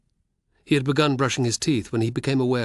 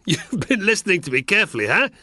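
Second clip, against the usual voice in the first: laughing; 10 semitones higher, 7 dB louder than usual. Acted. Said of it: "Navio laughed".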